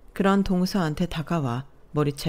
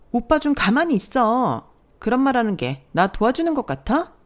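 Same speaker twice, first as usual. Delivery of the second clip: higher, irritated snap; sharp hushing; 6 semitones higher, 4 dB louder than usual. Acted. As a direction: shut her husband up fast. Sister-in-law hushes her husband. (남편 입을 막으며 다급하게)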